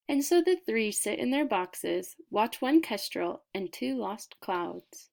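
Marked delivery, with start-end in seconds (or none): none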